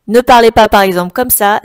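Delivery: very loud, close-miked demo of what not to do; bouche trop près du micro. Shown as a demonstration. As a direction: speak right up against the mic, overloud. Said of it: "ne mettez pas votre bouche trop près". (bouche collée au micro, voix très forte)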